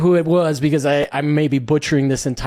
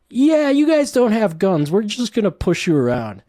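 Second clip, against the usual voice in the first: mocking smug governments; 6 semitones higher than usual. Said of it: "Australia and the U K are like".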